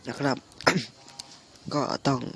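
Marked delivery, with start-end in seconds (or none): 0.4-1.7 wet cough from a sick chest. Real, not acted. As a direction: cough through phlegm. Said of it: "ก็ยังมีเสมหะ".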